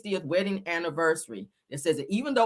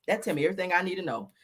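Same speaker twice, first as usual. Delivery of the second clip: ordinary talk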